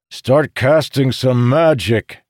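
slow, loud, commanding; stern order. A narrator's reading. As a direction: spell out each word like an order. Morgidian orders Jacob mid-battle.

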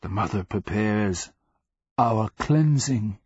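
deliberate rasping pronouncement; rasped. A narrator's reading. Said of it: "the slave rasped".

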